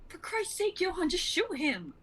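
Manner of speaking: high, exasperated shout at her partner; frustrated command to shoot; angry yell. Acted. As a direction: bark the order over the chaos. Luca yells over the crowd.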